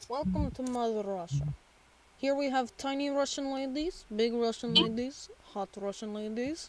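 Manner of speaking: Russian host listing the attractions; sing-song Russian sales patter; Russian accent. Pretend. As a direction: show them round in a mock Russian accent, lilting up. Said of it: "Russians are cartoon characters".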